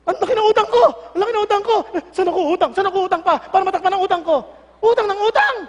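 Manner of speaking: loud, high-pitched fretting over debts; mock panic. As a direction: wail in hammed-up alarm about money. Parent panics over sudden debts. (kunwaring natataranta sa utang)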